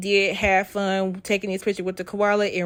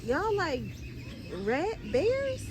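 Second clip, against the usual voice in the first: high puzzled voice; 11 semitones higher, 11 dB quieter, slower than usual. Acted. Puzzled by an animal.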